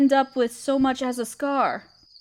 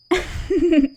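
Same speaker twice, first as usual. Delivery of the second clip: a short, light laugh of amusement; light amused laugh; 4 semitones higher, 3 dB louder than usual. Acted. Spider queen amused by her guest's question, light laugh. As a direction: chuckle as if the question is charming.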